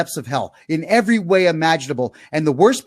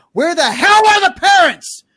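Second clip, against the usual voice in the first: loud, high angry yell; scolding parents with a real yell; 4 semitones higher, 3 dB louder than usual. Real, not acted. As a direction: rail at the parents. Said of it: "Now I'm gonna yell at you for this".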